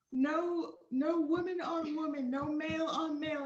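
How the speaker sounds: high, loud, emphatic proclamation; mock instructor rule-giving. Instructors forbidding pairings to airmen, loud mock decree.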